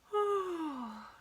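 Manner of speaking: long, high sighing yawn. Acted. Reader acts out the gorilla yawning for children, a sighing yawn. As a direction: let out a drawn-out sleepy yawn. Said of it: "The gorilla's yawning".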